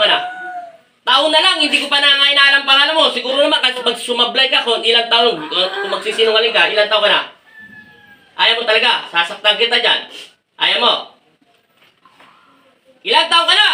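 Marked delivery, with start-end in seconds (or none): 1.0-3.1 raised, high-pitched insistent questioning; insistent demand. Real, not acted. Exorcist pressing the spirit for an answer. 13.0-13.7 high, rising interrogation; real exorcist's challenge; forceful demand. Real, not acted. Repeats question after no answer.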